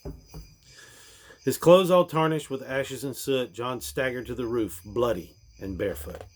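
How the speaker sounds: ordinary delivery, nothing marked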